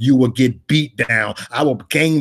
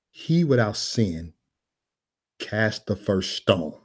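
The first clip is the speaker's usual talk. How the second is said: slow, softer, measured pronouncement; solemn Jesus; 3 semitones lower, 5 dB quieter, much slower than usual. Acted. Jesus answering the crowd with stones ready, slow and solemn. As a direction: say it slowly and quietly, phrase by phrase. Acts Jesus addressing the accusers.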